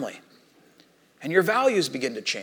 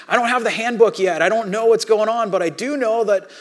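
raised, insistent retold defence; loud protest; 6 semitones higher, 6 dB louder than usual. New believer explaining himself to his friend, loud retold protest. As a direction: plead your case louder and higher.

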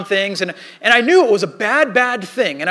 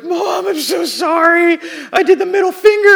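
crying confession; 10 semitones higher, 7 dB louder than usual. Retold or imitated. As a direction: sob it out to mom all at once. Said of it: "and I just cried".

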